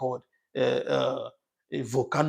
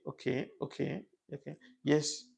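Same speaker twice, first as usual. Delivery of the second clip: ordinary talk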